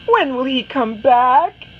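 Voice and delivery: Anna nags her mother again; impatient child. Acted. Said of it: "she asks her mother".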